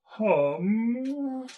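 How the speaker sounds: high, loud drawn-out donkey moan; last gasp of a pack animal; dying donkey voice. Acted. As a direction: moan like an expiring talking donkey. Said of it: "as he expires, he says".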